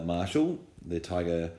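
ordinary talk

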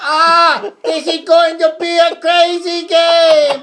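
high, slow, loud hammed-up Myron Cope voice; Myron Cope impression. Joking with buddies about Steelers, hammed-up Myron Cope voice. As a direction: screech it out like the old Steelers announcer.

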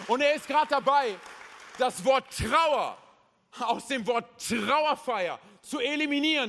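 loud, high, rousing proclamation; full-force preaching. Acted. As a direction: hammer each promise out to the crowd.

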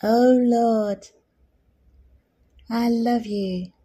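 slow, with a long pause after Lord; slow tender prayer. Shown as a demonstration. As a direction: lead a prayer slowly, tenderly, phrase by phrase. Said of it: "Say it slowly".

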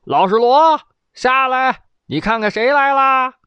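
high, loud call up to the roof; shouting up. Acted. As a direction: yell cheerfully up to the old man. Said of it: "扯开嗓子喊".

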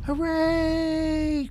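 very high cheering shout; crowd cheer. Acted. Armies cheering the surviving children, high cheer.

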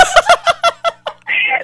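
laughing high up; high burst of laughter. Real, not acted. Guest cracking up over hiding the unsigned door, high laugh. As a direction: let the laugh break out high.

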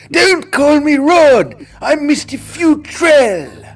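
high, loud, slow, indignant teacher insisting on his title; mock teacher. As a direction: draw it out like an offended teacher. Teacher's voice, imitated.

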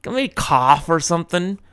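ordinary delivery

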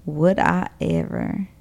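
low, soft and slow; playful drawn-out echo. Real, not acted. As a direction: echo it slowly, drawing out each word. Echoes reply, drawn out slowly.

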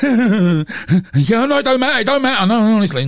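high, loud, falsely breezy reassurance; forced politeness. Retold or imitated. Telling the late friend it doesn't matter, falsely breezy. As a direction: insist it's fine while seething. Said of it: "It's inwardly seething".

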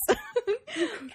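squealing laugh; high burst of laughter. Real, not acted. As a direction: shriek with laughter. Laughing at code word.

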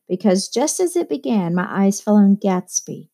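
ordinary delivery, nothing marked

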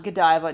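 Godiva said as guh-DIE-vuh; Godiva with a long I in the middle; rejected pronunciation. Shown as a demonstration. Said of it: "the company is pronounced Godiva".

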